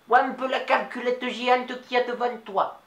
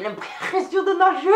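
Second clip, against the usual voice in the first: high aside; 8 semitones higher, 5 dB louder than usual. Acted. Comic aside.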